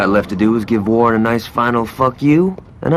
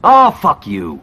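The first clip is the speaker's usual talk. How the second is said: annoyed outburst; 6 semitones higher, 5 dB louder, much slower than usual. Acted. Insulted by partner.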